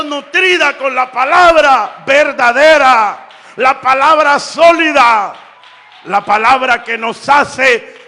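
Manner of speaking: loud, full-force pulpit preaching; fiery preaching. Acted. Preacher urging the church about judgment day, loud fiery preaching. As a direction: preach it out to the back pews.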